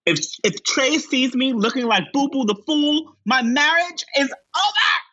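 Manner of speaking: high, distraught wail; wailing LaChance. Retold or imitated. LaChance wailing from behind the locked door, high and distraught. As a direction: cry it through the door. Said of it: "LaChance from behind the door say".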